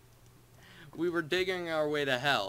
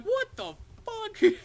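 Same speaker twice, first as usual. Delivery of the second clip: high, slow, laughing disbelief; laughing surprise; 6 dB louder, slower than usual. Retold or imitated. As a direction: squeal it out laughing in shock. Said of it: "I was just like".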